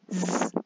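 Z đọc /z/ rung, trầm hơn; ending z sound. Shown as a demonstration. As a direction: buzz a low z. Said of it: "Cái thứ hai". (âm z hữu thanh)

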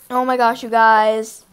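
ordinary talk, nothing marked

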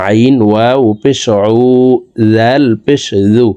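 ordinary talk

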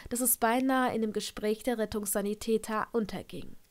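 plain reading voice

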